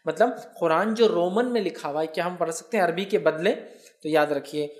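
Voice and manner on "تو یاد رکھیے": normal talking voice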